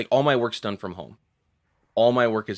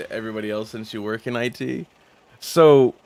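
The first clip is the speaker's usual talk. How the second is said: usual speaking voice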